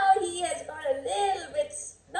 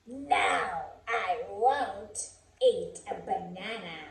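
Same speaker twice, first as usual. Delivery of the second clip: grumpy chimp refusing; 7 semitones lower, 5 dB quieter than usual. Acted. Chimp refusing, low and soft.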